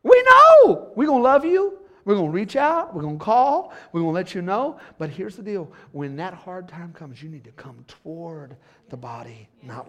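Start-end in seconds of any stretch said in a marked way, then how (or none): 0.0-4.8 very high, loud, impassioned climax; preaching at full force. Acted. Pastor promising the church, loud preaching. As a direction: cry it out to the church.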